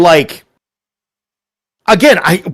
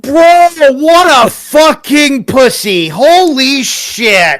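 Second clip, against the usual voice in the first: outraged shout; 8 semitones higher, much slower than usual. Real, not acted. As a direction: yell it out in disbelief. Outraged at being blocked.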